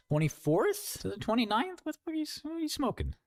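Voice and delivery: high, fast squeak of disbelief; incredulous falsetto jab; mock disbelief. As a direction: squeal it like you can't believe them. Mocking a wrong date.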